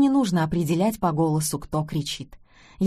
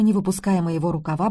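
usual speaking voice

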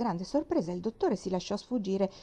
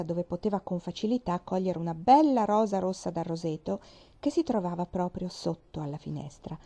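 ordinary delivery

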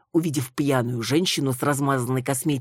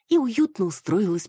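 usual speaking voice

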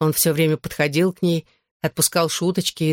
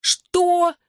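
very high-pitched, startled "what"; shocked cry; 13 semitones higher than usual. A narrator's reading. Friend reacts to news of the lift home, crying out. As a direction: shriek it in disbelief, without much extra volume. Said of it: "крикнула Клэр".

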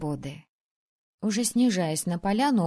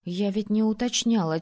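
plain reading voice

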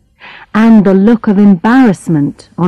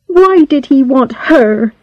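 put-on higher-pitched, jealous girl; 5 semitones higher than usual. A narrator's reading. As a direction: ask it with a touch of jealousy, as if to yourself. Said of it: "She sounded jealous".

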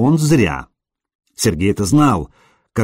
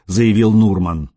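usual speaking voice

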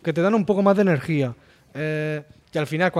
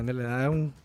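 usual speaking voice

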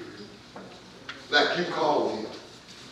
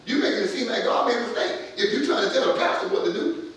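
usual speaking voice

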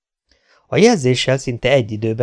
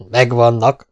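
triumphantly; 4 dB louder, slower than usual. A narrator's reading. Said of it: "közölte diadalittasan".

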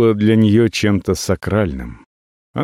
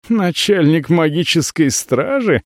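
scoffing; 6 semitones higher than usual. Acted. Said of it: "фыркнула Наца".

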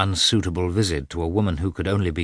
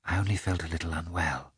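soft, gently smiling reassurance; sweet, significant smile; 8 dB quieter, faster than usual. A narrator's reading. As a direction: play it down softly and sweetly. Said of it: "she added with a peculiarly sweet".

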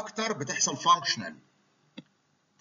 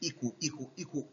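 fading echo; 10 dB quieter than usual. Shown as a demonstration. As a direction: echo repeated, much softer. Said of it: "فالكلام بيترد لك تاني".

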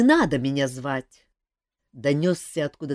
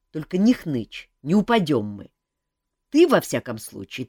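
ordinary delivery

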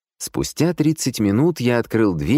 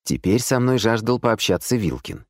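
ordinary delivery, nothing marked

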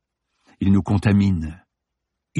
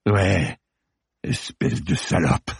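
lower, growled with a different note; 2 semitones lower, slower than usual. A narrator's reading. Ronan growling an insult at Samira, lower. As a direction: growl it low and menacing. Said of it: "gronda-t-il avec ... note différente, très basse".

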